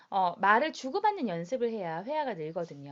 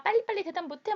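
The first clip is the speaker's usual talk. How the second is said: imitated dad pressing for an answer, higher-pitched; mock hurry-up, pitched well up; impatient dad urging; 9 semitones higher than usual. Extroverted dad pressing his introverted daughter to answer, mock impatient. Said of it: "외향인 아빠가".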